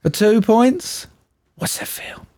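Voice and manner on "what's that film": very soft, whispered quiz question; hushed catchphrase. Pretend. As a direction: whisper it like a mysterious quiz cue.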